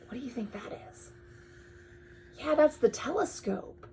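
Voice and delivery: plain reading voice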